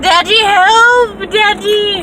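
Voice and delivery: high, loud, drawn-out cry of Daddy, help; panicked call for help. Retold or imitated. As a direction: call out like a frightened child in trouble. Retold panicked call to dad.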